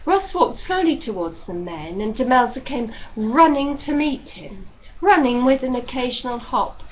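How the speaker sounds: ordinary delivery, nothing marked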